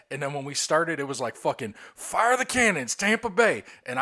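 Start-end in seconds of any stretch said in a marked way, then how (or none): 1.9-3.6 high, slow stadium call; announcer shout. Retold or imitated. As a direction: bellow it like a hype announcer. Calling out a sports cheer.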